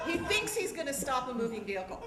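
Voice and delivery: ordinary talk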